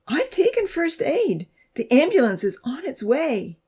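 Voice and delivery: high, insistent plea; high-pitched anxious urging; pleading. A narrator's reading. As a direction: plead with a stubborn patient. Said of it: "she pleaded".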